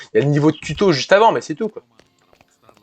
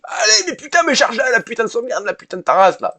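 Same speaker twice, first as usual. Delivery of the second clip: much higher, cursing at the game; real rage yell; 9 semitones higher than usual. Real, not acted. Streamer cursing at the game's failed save, high furious yell. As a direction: yell at the save that won't load. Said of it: "je suis désolé, je gueule déjà".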